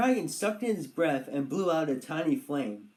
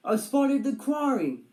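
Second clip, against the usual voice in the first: called out; 7 semitones higher, 5 dB louder than usual. A narrator's reading. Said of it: "Roger called".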